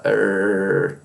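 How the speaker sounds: "er" as a long, low curled R; English R sound. Shown as a demonstration. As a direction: hold "er" low on a curled tongue. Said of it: "característica".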